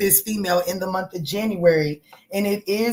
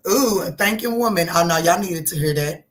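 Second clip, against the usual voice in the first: normal talking voice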